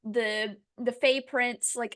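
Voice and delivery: normal talking voice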